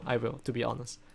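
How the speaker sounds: ordinary talk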